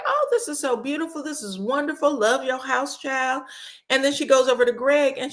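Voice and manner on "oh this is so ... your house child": mock gushing. Stacy gushing, retold.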